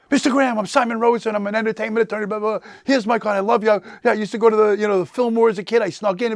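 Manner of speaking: imitating his own younger, breathless self; eager fanboy pitch. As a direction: rattle it off like a starstruck kid meeting his hero. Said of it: "And I'm like".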